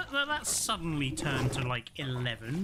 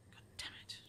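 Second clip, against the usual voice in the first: whispering; 17 dB quieter, much faster than usual. Real, not acted. Quiet curse during tense game.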